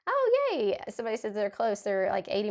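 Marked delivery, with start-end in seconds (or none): 0.1-0.8 excited squeal. Real, not acted. Delighted reaction to viewer comment.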